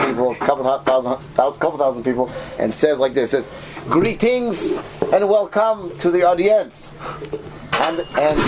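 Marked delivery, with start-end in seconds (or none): 3.9-6.9 slow, high stress on the wrong syllables of "Greetings and welcome to the audience"; wrongly accented announcement. Shown as a demonstration. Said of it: "That would sound weird".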